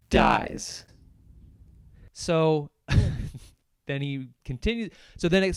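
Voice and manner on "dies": dramatic dies. Pretend. Dramatic death in movie recap.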